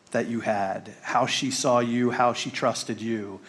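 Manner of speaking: ordinary talk, nothing marked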